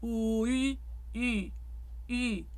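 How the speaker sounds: oo, ee shifting with lips rounded; oo into mixed Y. Shown as a demonstration. Coach demonstrating the mixed Y vowel to singers. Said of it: "say oo and raise the tongue".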